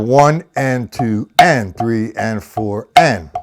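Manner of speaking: slow count, punching "and" after "two" and after "four"; stress on the offbeat AND. Shown as a demonstration. Drum teacher demonstrates shifted accent, stressing "and" after "two" and "four". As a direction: hit the "and" following "two" and "four". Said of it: "moved the accent over to the and".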